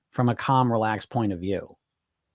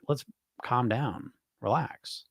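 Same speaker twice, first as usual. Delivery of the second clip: softer, soothing coach voice; calming; 8 dB quieter, slower than usual. Shown as a demonstration. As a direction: gently settle a panicked client. Coach calming stressed clients.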